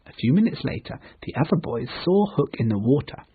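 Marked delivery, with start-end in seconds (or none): none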